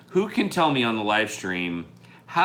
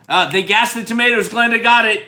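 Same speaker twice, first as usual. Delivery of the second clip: loud, fast excited reveal; 11 semitones higher, 9 dB louder than usual. Real, not acted. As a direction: blurt out the answer with glee.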